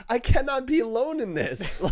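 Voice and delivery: high-pitched mock despair, half laughing; exasperated protest. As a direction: plead it in comic disbelief. Re-enacts triggered protest to himself.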